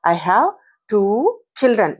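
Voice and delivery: put-on "I have... two... children", broken by pauses; word-by-word demonstration. Shown as a demonstration. Teacher modelling pronunciation for learners, word by word. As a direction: separate "two" and "children" clearly. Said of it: "వాటిని ఎలా పలకాలి".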